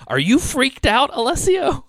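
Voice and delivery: put-on high, loud, slowed worried older lady; Joan's voice. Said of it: "she goes".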